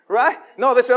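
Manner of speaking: ordinary talk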